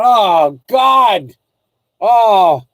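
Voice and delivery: loud, high groan of "oh God"; exasperated outcry. Retold or imitated. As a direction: let out a big, drawn-out exasperated wail. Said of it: "And I went like this".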